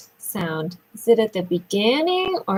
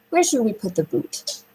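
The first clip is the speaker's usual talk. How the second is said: ordinary talk, nothing marked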